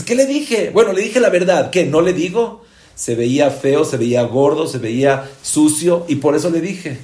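mock indignant excuse-making; acted self-justification. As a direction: justify yourself loudly and indignantly. Acting out a defensive gossiper.

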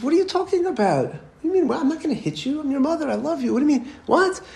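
very high, incredulous mom voice; bewildered mother. Retold or imitated. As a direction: imitate a mother protesting in disbelief. Mother baffled by son's accusation.

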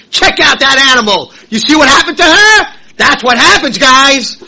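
loud, high mocking jeer; gossiping crowd. Mocking crowd pointing at animal.

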